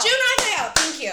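loud, high echoing cheer; triumphant recall. Real, not acted. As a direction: shout the name back in relief. Echoing the name just remembered.

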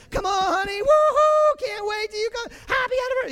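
very high, loud whooping for the spouse; mock anniversary cheer. Preacher mocking loud cheering for his wife on their anniversary. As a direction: cheer for your wife like a sports fan. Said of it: "I don't cheer downstairs".

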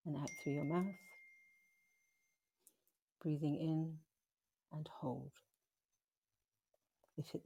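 slow, gentle meditation voice with long pauses; guided meditation. Acted. Guiding listeners through a forgiveness meditation, slow and gentle. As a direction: ease the listener through the breath hold softly.

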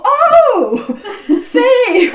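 squeaky high "Oh, Faye" of sudden understanding; mock realization. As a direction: act the penny dropping. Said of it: "And they go".

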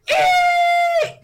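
piercing high squeal of brakes. Retold or imitated. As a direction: shriek like screeching tires. Sudden braking in the pitch.

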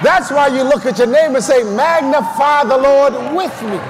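forceful, rhythmic sermon delivery; full-force preaching. Real, not acted. Preacher urging the church to magnify the Lord, full-force preaching. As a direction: drive each phrase out to the whole church.

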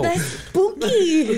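slow laughing spooky; drawn out giggle. Real, not acted.

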